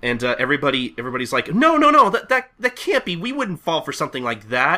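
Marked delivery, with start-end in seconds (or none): 1.5-4.8 high protest, loudest at the start; shocked denial. Acted. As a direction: protest like a crowd in disbelief. Crowd in denial.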